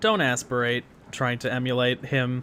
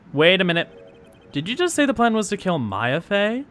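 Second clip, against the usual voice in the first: shocked; 7 semitones higher, 5 dB louder than usual. Acted. Courtroom objection, high and loud.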